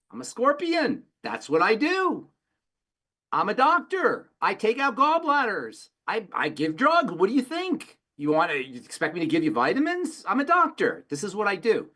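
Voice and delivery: the scorpion as doctor excusing himself to the frog, high and smug. Retold or imitated. Scorpion-doctor voiced in fable.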